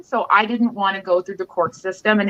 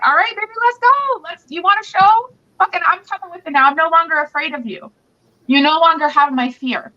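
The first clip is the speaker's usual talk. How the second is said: much higher, fired-up taunt; defiant challenge; 7 semitones higher than usual. Retold or imitated. Woman taunting her ex in the street, defiant and fired up. She stands up to him.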